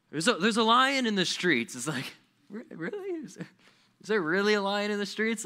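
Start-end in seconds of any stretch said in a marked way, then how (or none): 0.1-1.8 higher, louder mock alarm; hammed-up warning, raised voice; lazy person's excuse. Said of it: "They're like". 2.5-5.4 high-pitched sceptical questioning; incredulous, much higher voice; mock disbelief. Sceptical reply to absurd excuse.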